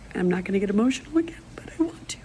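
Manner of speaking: hushed unvoiced confession; whispered. Real, not acted. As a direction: whisper it to keep from crying. Whispers, holding back tears.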